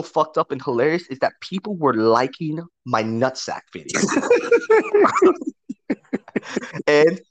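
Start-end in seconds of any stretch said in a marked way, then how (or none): 4.0-6.8 high-pitched burst of laughter; laughing. Real, not acted. Storyteller cracking up at his own nutsack video, high laugh.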